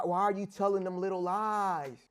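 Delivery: ordinary talk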